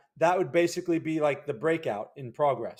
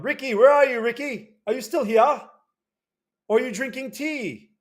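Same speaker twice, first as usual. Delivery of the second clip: loud, higher teasing call across the room; 9 semitones higher, 7 dB louder than usual. Real, not acted. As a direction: playfully shout for Ricky. Calling out to absent viewer.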